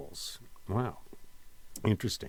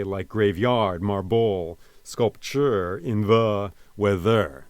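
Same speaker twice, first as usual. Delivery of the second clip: "Like graveyard marble sculpture in the weather" slowly stressed on YARD, BLE, TURE, THE, THER; weight every wrong syllable: grave-YARD mar-BLE sculp-TURE in THE wea-THER; wrongly stressed meter; 4 dB louder, slower than usual. Shown as a demonstration. Teacher showing how weird it would be, stressing YARD, BLE, TURE, THE, THER. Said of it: "we don't say".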